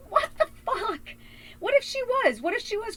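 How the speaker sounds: high, incredulous protest; high-pitched, exasperated challenge; exasperated outburst. Real, not acted. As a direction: voice shoots up in indignant disbelief. Outburst at retold accusation.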